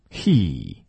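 He with mouth pulled in, narrow ee; He, not hey; 收口读he; correct he. Shown as a demonstration. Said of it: "收回来".

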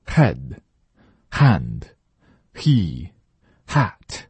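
Head, hand, he, hat very slow, breath pushed from the throat; 慢速示范h音; slow h demo. Shown as a demonstration. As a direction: stretch each h breathily. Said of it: "让这口气儿直接从喉咙里出来".

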